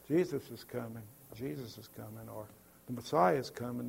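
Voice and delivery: a soft, limp, half-hearted call; mock meek announcement. Shown as a demonstration. Preacher mocking a timid herald, soft and lifeless. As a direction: say it quietly like nobody cares. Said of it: "No, it was a loud voice".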